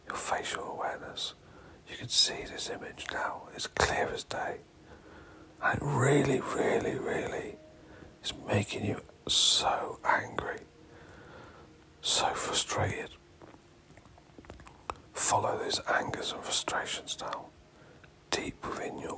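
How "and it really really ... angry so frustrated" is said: forceful stage whisper, louder on the intensifiers; intense, emphatic whispering. Real, not acted. Guide stirring listener's anger at the person, intense whisper. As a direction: push the whisper hard to stir up anger.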